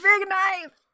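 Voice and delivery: high, loud echo of the cry; mock dramatic shout. Friend joins in the big knife line, mock dramatic shout. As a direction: fling it out like a stage tragedian.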